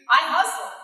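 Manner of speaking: hyped declaration; rallying cry. Real, not acted. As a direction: fire up the crowd. Rallying the audience.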